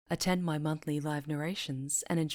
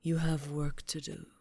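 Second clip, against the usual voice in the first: sinister sorcerer; slower than usual. Acted. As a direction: purr every threat like a gloating dark lord. Villain addresses captive witch.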